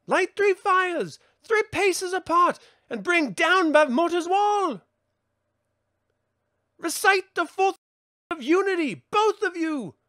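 urgent instructions. Acted. Sorceress giving spell instructions.

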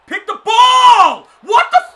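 very high, drawn-out full shout; furious scream. Real, not acted. Gamer screaming at his player to pick the ball, furious.

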